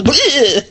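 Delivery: sudden loud ghostly bleh to scare; startling boo. Pretend. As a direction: jump out and yell bleh. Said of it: "jumps out and goes".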